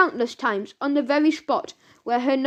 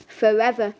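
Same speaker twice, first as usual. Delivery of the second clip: ordinary delivery, nothing marked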